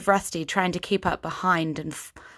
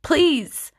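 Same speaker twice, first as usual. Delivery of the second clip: desperate plea, higher and louder; begging; 10 semitones higher, 6 dB louder than usual. Acted. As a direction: beg them, at the end of your rope. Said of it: "Kyra begged, frustrated and desperate".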